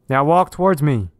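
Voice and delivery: policeman calling orders. A narrator's reading. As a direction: call it firmly across a distance.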